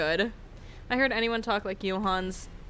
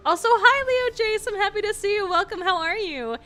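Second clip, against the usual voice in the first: excited; 9 semitones higher, 4 dB louder than usual. Real, not acted. Greeting new chatter excitedly.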